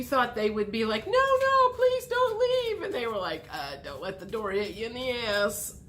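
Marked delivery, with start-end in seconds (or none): 1.1-2.8 very high, slow begging; mock pleading. The others begging Gemini to stay, high mock pleading. 3.5-5.8 flat, softer brush-off; deadpan shove out the door; dry dismissal. Retold or imitated. The others shrugging Gemini off, deadpan brush-off.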